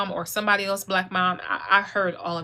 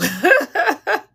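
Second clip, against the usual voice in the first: a high, loud burst of laughter; 9 semitones higher, 9 dB louder than usual. Real, not acted. Vlogger laughing at her own mom impression. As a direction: laugh out at the memory.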